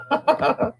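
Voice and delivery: short high laugh; real high-pitched giggle. Real, not acted. Host showing friends the picture, laughing.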